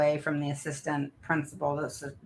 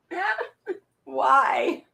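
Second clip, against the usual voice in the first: ordinary delivery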